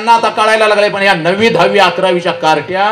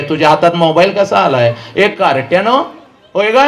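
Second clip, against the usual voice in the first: usual speaking voice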